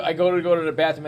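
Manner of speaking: ordinary talk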